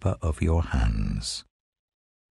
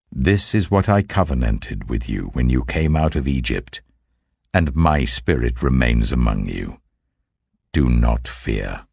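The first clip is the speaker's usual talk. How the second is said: lower, solemn divine voice; a measured, lower voice of the Lord giving commands; 7 semitones lower than usual. Acted. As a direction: speak as God addressing his prophet, gravely. Voicing the Lord's words.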